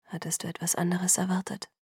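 softly voiced, subdued sadness; a quiet, understated note of hurt; sad. A narrator's reading. Boyfriend answers about the house ban, sadly. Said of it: "Er klang traurig".

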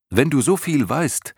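put-on high, loud young boy challenging; high loud boy, straining against the old creature; determined boy. A narrator's reading. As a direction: push back hard, young and defiant. Atreju challenges the Morla.

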